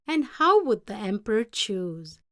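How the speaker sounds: ordinary delivery, nothing marked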